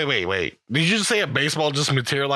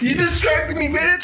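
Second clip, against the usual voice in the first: high-pitched accusation through laughter; laughing protest; 12 semitones higher than usual. Real, not acted. Player scolding the GM for distracting him, laughing high protest. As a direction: blame them while cracking up.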